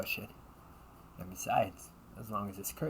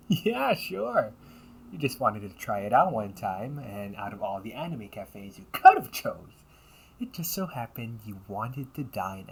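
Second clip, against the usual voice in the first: louder, mocking disbelief; loud sarcasm; 8 dB louder than usual. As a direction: scoff openly at the flimsy excuse.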